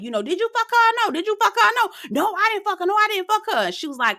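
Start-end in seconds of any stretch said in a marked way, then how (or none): 0.2-1.9 high, furious demanding voice; Shay on ten. Retold or imitated. Shay on ten, demanding the truth from Josh. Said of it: "on ten at this point". 2.2-3.7 high, squeaky panicked denial; mock frantic denial. Host mocking Josh denying to Shay, high and panicky.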